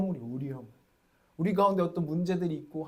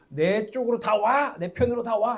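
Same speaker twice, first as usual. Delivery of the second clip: louder, higher mock command; bossy rallying cry; 4 semitones higher, 6 dB louder than usual. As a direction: order everyone over to your side. Mimics bossy rallying in prayer. (강압적으로 몰아붙이는 외침 흉내)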